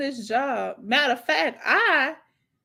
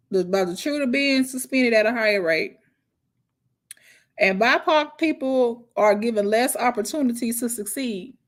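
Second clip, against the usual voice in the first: ordinary delivery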